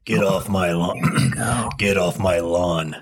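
low, slow Clint Eastwood growl; grumpy old man in Clint Eastwood's voice. Acted. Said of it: "I gotta get into McClint Eastwood".

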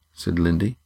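usual speaking voice

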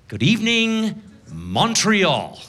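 stage hello, high start dropping on the city; re-greet the audience as host; show opening greeting. Retold or imitated. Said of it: "and I'm like".

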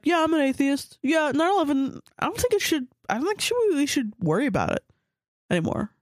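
put-on high, smug kid voice; mocking schoolgirl. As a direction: play a smug middle-school know-it-all. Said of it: "she was like".

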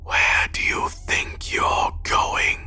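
slow, drawn-out rasping whisper; moist, gurgled whisper trickled slowly out; gurgling gray man. A narrator's reading. Gray man whispers right into the driver's ear, slow wet gurgle. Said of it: "gurgled words slowly trickled".

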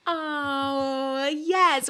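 high, loud gasp of joy; light up with delight; thrilled yes. Real, not acted. Wife accepting husband's Valentine question, thrilled and high.

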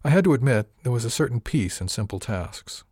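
ordinary delivery, nothing marked

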